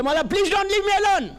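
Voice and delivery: high, drawn-out acted cry; desperate plea. Ibrahim running after the Imam, desperate plea. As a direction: call after someone walking away. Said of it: "یہ پیچھے بھاگنے لگا کہ".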